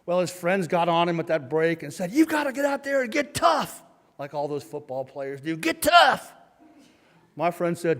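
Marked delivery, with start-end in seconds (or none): 2.1-3.8 higher, put-on hectoring voice; egging-on friends. Friends get on boxer during break, hectoring pep talk. 5.6-6.3 high, loud put-on yell; shouted egging-on. Friends goad boxer during break, shouted urging.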